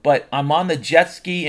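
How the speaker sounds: usual speaking voice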